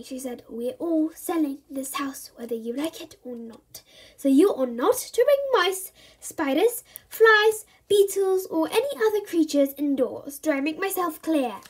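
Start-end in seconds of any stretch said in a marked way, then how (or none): 0.5-3.8 angry whisper. Acted. Mum grinds teeth. 4.2-11.6 scolding mum. Acted.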